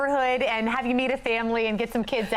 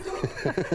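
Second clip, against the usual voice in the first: kind gentle laugh; 4 dB quieter than usual. Real, not acted. Anchors laugh at snowman.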